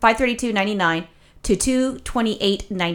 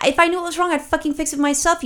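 high, raised-pitch mock outburst; exasperated retort; 7 semitones higher than usual. Retelling her reply to the chat agent, exasperated. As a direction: throw it back at her, fed up.

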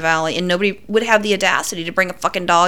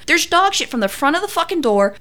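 ordinary talk, nothing marked

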